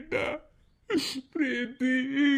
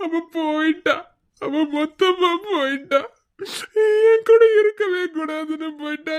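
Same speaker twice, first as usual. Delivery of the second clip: tearful, despairing wail of self-blame; crying. Acted. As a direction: sob through the words, rising to an anguished cry. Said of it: "தயவு செஞ்சு அழுகிறத முத நிறுத்துங்க". (கதறி அழும் குரல்)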